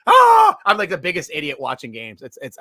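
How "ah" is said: loud high shouting at the game; screaming fan yelling at the television. Retold or imitated. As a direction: yell at the players like a frantic fan. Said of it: "I'm always like".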